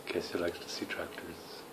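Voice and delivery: a real whispered comment while reading. Real, not acted. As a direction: breathe it as a hushed whisper. Parent aside while reading with toddler.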